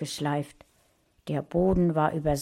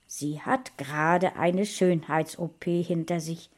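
plain reading voice

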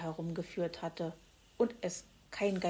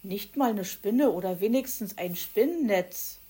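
bored bellow; 2 semitones higher, 7 dB louder than usual. Acted. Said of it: "brüllte Borstel gelangweilt".

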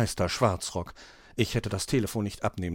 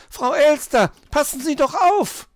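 high, loud urgent yell; shouted warning; 7 dB louder than usual. A narrator's reading. Cat warns magpie in the sledge's path, high shout. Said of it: "rief Mauz".

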